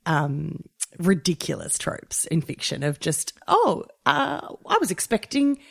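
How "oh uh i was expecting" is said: lightly hammed-up eager receptionist, higher; mock greeter. Host parodying the careless greeter trope, mock-eager and higher. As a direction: gush the welcome like a fussy doorman.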